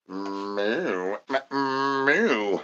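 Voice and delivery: quiet low mock mooing; soft cow moo. Cow noise after refrain.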